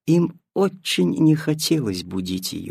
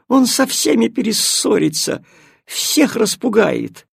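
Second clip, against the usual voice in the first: shrill cat complaint; 10 semitones higher, 4 dB louder than usual. Acted.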